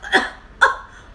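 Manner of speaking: loud, sharp pretend hiccup; mock hiccup. Storyteller plays the hiccuping elephant, mock hiccup. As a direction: let out a sudden acted hiccup.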